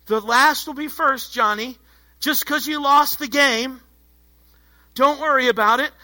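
lightly mocked parental pep talk; consoling parent. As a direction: cheer up a child who lost the game. Parent consoling a child after losing.

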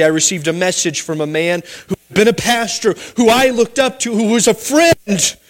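ordinary delivery

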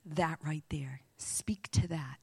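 soft, breathy inner whisper, slowing at the end; whispered prompting. Retold or imitated. Holy Spirit prompting the preacher in a whisper. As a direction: nudge gently in a hushed whisper. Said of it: "the Holy Spirit goes".

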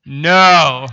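a loud, decisive no; firm no. Retold or imitated. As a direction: shut the offer down flat. Firmly refusing sauce at window.

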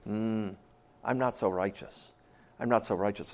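low, hushed, humbled admission; small, subdued owning-up; acted sheepishness. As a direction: confess it quietly, voice dropped low. Acting out shame before God's righteousness.

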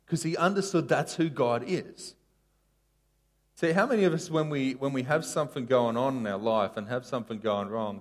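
normal talking voice

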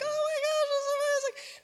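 put-on very high, squealing girly gush; gushing girls. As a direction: squeal it like excited young girls. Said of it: "all the girls were like".

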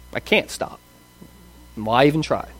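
whispered, giving up before trying; hopeless whisper. Retold or imitated. A man who can't quit cursing, whispering in defeat. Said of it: "And they just".

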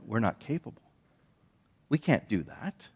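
mild, deflated "we can't" as if giving up; defeated disciples. Retold or imitated. Disciples telling Jesus they can't, quietly defeated.

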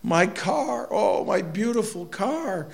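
high, wailing lament for a wrecked car; mock sobbing wail. Preacher imitating a sobbing lawyer beside his wreck, mock wail. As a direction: ham up a grieving wail. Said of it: "the man is just sobbing".

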